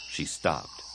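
ordinary delivery

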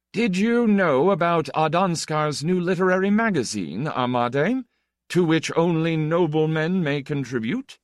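high, animated society gossip; gossiping flippant Count. Acted. The Count shares magazine gossip with the writer, flippantly.

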